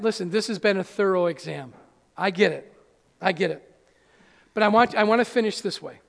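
ordinary talk, nothing marked

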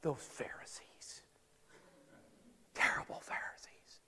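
hushed mock whisper of smug churchgoers. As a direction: whisper it like gossiping about the Pharisees. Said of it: "we go".

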